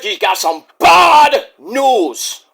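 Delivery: slow, drawn-out mock British announcer; badly faked British commentator; bad British accent. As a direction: stretch it out in a shaky-quality British impression. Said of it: "my impression sounds like a Jamaican".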